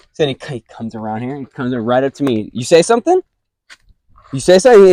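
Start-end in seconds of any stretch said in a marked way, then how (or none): 2.5-4.9 high, loud mock challenge; imitating a tough kid, pitched up and louder; imitating a bully. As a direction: sneer it like a kid picking a fight. Bully coming right up to him.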